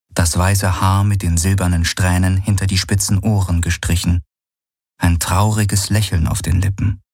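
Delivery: usual speaking voice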